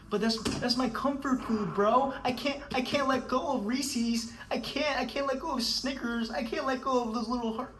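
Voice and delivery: high, whining mock voice of a snack addict; mock the whiny excuse of someone hooked on candy. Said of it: "You're like".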